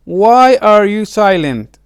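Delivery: usual speaking voice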